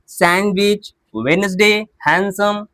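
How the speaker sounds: "सैंडविच, वेडनेसडे, हैंडसम" as san-witch, wens-day, han-sum; "सैंडविच, वेडनेसडे, हैंडसम" listed evenly without the silent D. Shown as a demonstration. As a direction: recap "सैंडविच, वेडनेसडे, हैंडसम" with each first D dropped. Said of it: "यहाँ पे क्या होता है? डी silent होता है".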